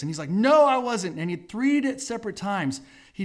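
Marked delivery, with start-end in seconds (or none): 0.3-1.2 loud, high, indignant protest; acted-out defensive outburst; emphatic denial. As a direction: deny it hotly, voice jumping up. Peter's denial acted when accused.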